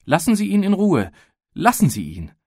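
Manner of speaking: high, loud tearful plea; girl begging the man, close to crying; near tears. Acted. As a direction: cry out, on the edge of weeping. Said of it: "Sie weinte schon beinah".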